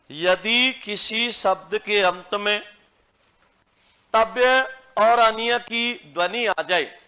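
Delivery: slow, spaced words for students to copy; slow dictation. Shown as a demonstration. Teacher dictating a rule to the class, slow and clear. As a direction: dictate each word slowly. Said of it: "dictation of rule repeated".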